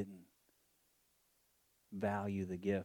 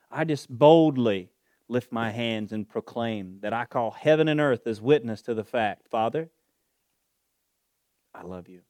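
preaching at full force; 3 semitones higher, 12 dB louder than usual. Acted. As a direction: call heaven and earth as witness at full voice. Prayer rising to full force.

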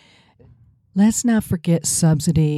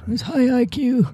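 sarcastic high voice; 9 semitones higher than usual. Pretend. Sarcastic praise of Trump.

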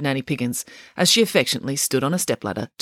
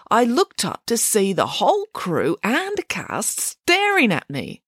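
high, lightly voiced bear, hurt; a sensitive bear explains himself, aggrieved; bear character; 6 semitones higher, slower than usual. Bear complaining to the family about the dull scene.

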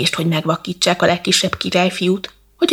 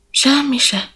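a higher-pitched, mildly sighed reply; sighing reply; 8 semitones higher than usual. A narrator's reading. Prince to the cat who asks his trouble, mildly sighing. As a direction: answer with a light narrated sigh, pitched up. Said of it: "és fölsóhajtott".

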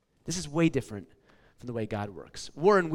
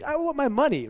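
pitched-up mock frustrated demand; 9 semitones higher than usual. Paperboy demanding payment at the door, mock frustrated. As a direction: whine it like a kid stiffed for his pay. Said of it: "And I would get so frustrated".

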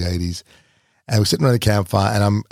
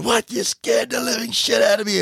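high, shocked outburst of relief; a scared uncle blurting out in disbelief; startled uncle; 13 semitones higher than usual. Retold or imitated. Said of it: "he looked at me and said".

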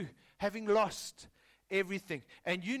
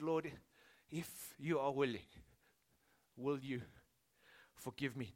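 quiet prayer; 5 semitones lower, 5 dB quieter than usual. Retold or imitated. Worship team praying to the Lord, hushed. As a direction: pray it low and humble. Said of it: "they've said".

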